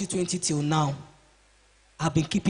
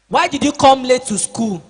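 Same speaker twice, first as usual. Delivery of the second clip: loud, sharp telling-off; scolding adult; 4 semitones higher, 9 dB louder than usual. Acted. Teacher scolding pupil who came late, loud demand.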